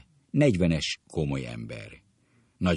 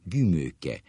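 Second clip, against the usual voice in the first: ordinary delivery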